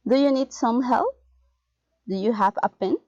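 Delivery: "help" and "pen" each lifted; rising intonation. Shown as a demonstration. Teacher models general questions, rising intonation on "help" and "pen". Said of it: "rising intonation".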